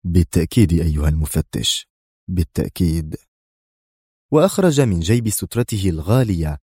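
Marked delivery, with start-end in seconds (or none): 0.0-3.1 low, unhurried calm reply; a hint of a calm elegant man. A narrator's reading. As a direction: answer with cool, smooth composure. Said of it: "وقال في هدوء شديد".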